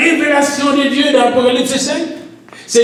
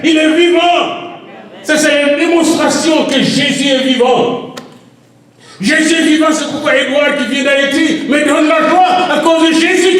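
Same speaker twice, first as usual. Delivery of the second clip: preaching at full force; 5 semitones higher than usual. Acted. Pastor proclaiming to the congregation that Jesus lives, full-force preaching. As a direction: shout the good news to the church. Peak of the sermon.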